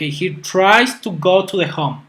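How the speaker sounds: slow, careful classroom reading of the example; deliberate teacher demo pace; slow model sentence. Shown as a demonstration. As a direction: read it out slowly so students can follow. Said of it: "teacher models example sentence".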